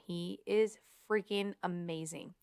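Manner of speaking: ordinary delivery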